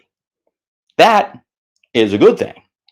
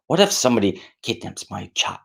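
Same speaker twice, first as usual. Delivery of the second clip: hushed unvoiced whisper of a dread thought; whispered fear; 3 semitones lower, 6 dB quieter than usual. Voicing a parent's fear, whispered. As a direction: breathe it like a secret fear.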